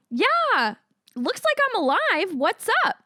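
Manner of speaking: high, bright and breezy; chirpy sarcastic. Retold or imitated. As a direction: cheerfully needle him. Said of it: "And I'm like".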